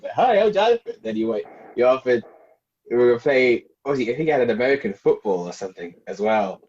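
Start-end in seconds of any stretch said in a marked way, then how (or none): 0.1-0.9 high, chirpy imitation of a friend; lightly imitated sunny intro; mock bright greeting. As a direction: pop in with a cheery hello.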